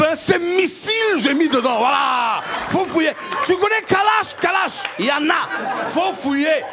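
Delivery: enraged Ivorian rant. Retold or imitated. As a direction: rage at him in broken French. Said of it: "la rage ... je l'ai versée sur lui".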